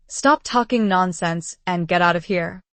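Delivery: plain reading voice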